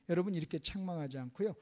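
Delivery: ordinary talk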